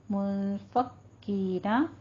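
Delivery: "मुनफकीना" slowly, the n hidden in a held hum; slow tajweed demo of "मुनफकीना"; ikhfa nasal hold. Shown as a demonstration. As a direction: hum the n before f in "मुनफकीना", long ee. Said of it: "नून साकिन का इख्फा करना है".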